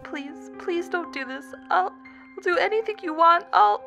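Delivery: high plea climbing in pitch; desperate begging. Acted. Jessica begs for mercy, desperate and choking. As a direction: plead frantically until cut off. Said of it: "Jessica's voice choked off into a wheeze".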